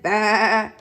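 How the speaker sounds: loud, drawn-out bleat; sheep's baa. A narrator's reading. Reader voices the sheep for a child, loud drawn-out bleat. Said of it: "Sheep said".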